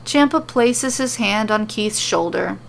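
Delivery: usual speaking voice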